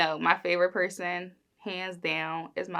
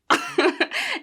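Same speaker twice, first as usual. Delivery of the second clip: high burst of giggling; laugh; 4 dB louder than usual. Real, not acted. Woman fumbling her skincare launch line, high giggle. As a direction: laugh at your own stumble. Laughs after stumbling on words.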